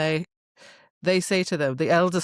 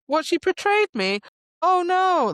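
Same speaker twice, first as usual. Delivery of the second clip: high, louder mock horror; mock shock; 13 semitones higher, 4 dB louder than usual. Mocking Tamlin's betrayal, hammed-up high shocked voice. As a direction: ham up a fake gasp of surprise.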